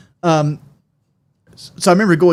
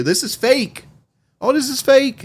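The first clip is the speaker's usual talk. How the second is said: mock indignant; 11 semitones higher, slower than usual. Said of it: "I'm like".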